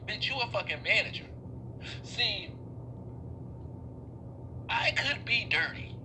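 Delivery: ordinary talk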